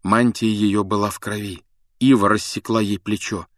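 usual speaking voice

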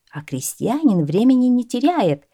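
ordinary delivery, nothing marked

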